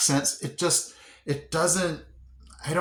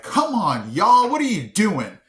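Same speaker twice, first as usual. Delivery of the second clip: louder, higher Southern-tinged scolding; exasperated teacher; 7 semitones higher, 6 dB louder than usual. Retold or imitated. Teacher to misbehaving students, mock exasperated scolding. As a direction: call out a mock telling-off. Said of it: "my students and they're misbehaving".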